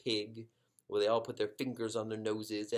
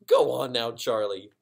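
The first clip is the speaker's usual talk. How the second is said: teasing disbelief; 13 dB louder than usual. Acted. Wife teasing husband disbelieving.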